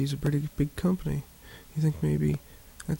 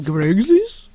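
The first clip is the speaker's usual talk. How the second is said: louder, higher laughing question; laughing disbelief; 5 semitones higher, 7 dB louder than usual. Real, not acted. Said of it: "It's Greg's List?".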